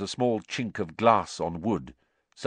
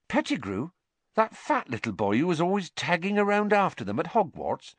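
high-pitched, mildly incredulous landlady; surprised query; 12 semitones higher than usual. A narrator's reading. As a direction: ask it in high, lightly voiced surprise. Landlady's astonished question.

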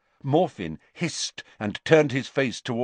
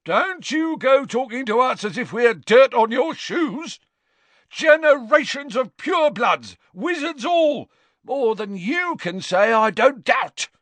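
angry shout; 6 dB louder than usual. Acted. Gaunt rants at the official.

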